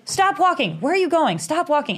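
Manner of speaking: high, urgent mock commands; retold exasperated orders. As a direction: imitate a frustrated man directing someone by phone.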